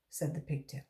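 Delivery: plain reading voice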